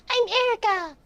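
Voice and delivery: put-on high-pitched nine-year-old; very high child voice; kid voice. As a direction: introduce yourself like a small child. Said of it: "I'm reading in this kid voice".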